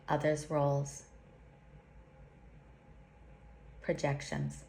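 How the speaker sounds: slow, solemn prayer with long pauses; slow invocation. Acted. Teacher leads listeners through a protection invocation, slowly and solemnly. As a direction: intone each line like a sacred vow. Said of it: "I'll say it slowly".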